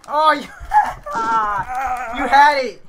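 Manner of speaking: high loud laughing outcry; excited shriek. Real, not acted. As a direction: squeal it out, laughing. Teammate reacting to failure.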